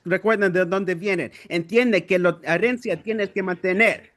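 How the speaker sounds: rhythmic Spanish slam poetry, steady drive; spoken word poem. Acted. Poet reading his Spanish piece to the open mic, rhythmic and driven. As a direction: lay the lines down like a slam poet.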